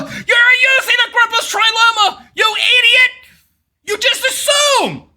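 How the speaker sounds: high-pitched berating yell; high, hammed-up outrage; exasperated shout. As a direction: shout it like a scolding accusation. Berates opponent, calling her idiot.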